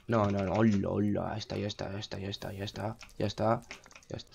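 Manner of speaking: low, soft repeated muttering; muttered self-soothing. Real, not acted. Gamer after the house fire, quiet low muttering. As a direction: mutter it under your breath as things settle. Defeated muttering while fixing the damage.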